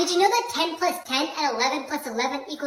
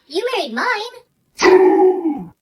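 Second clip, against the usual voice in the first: louder, very slow punchline; smug comeback; 9 dB louder, much slower than usual. Acted. Son to father, slow loud comeback. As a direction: drag the comeback out.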